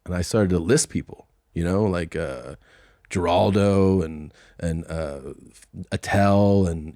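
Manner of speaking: ordinary delivery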